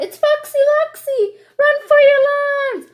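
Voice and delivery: high, loud frantic yell. Acted. Chicken Little yelling the warning again, high and loud. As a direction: yell it out in a panic. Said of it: "So she yells out".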